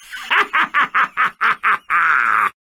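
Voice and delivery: loud gloating villain laugh; evil laugh. Acted. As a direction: laugh wickedly at the apocalypse.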